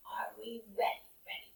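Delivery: put-on whispered, leaning in to the kids; hushed whisper. Teacher to children before the adventure, whispered. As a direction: breathe it like a secret start.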